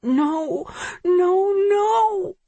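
high, rising whine; whining. A narrator's reading. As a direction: plead in despair. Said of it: "whines the voice".

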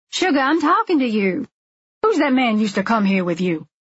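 high, pushy call for attention, then settling; sassy woman who won't be ignored; insistent busybody. A narrator's reading. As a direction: demand her attention, starting high. Nosy woman demands an answer.

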